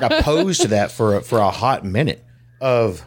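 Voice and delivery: usual speaking voice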